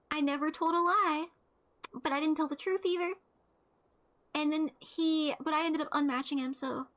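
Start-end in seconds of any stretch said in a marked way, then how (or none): none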